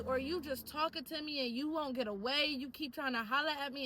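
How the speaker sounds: normal talking voice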